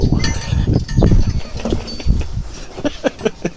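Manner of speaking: wheezy, mostly breath laughter; long quiet laugh. Real, not acted. Streamer after correcting weed to tobacco, long soft laugh. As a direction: keep chuckling softly, breathily.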